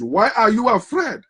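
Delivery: usual speaking voice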